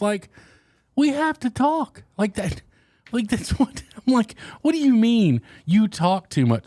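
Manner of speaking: high-pitched, exasperated disbelief; incredulous protest. Real, not acted. Vlogger answers viewers who say he talks too much, incredulous.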